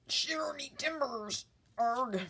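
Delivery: high, loud hammed-up pirate growl; mock pirate bluster for Talk Like a Pirate Day. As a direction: play a cartoon pirate, big and loud. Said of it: "Talk Like a Pirate Day".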